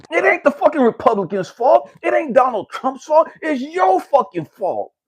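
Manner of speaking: furious preacher-style shout; angry shout. Real, not acted. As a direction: yell it straight at them. Ranting at the audience.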